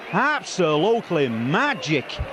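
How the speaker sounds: low slow delighted sigh; awed, slow and low. Real, not acted. Awed summary.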